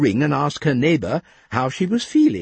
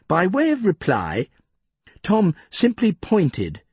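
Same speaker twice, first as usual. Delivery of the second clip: usual speaking voice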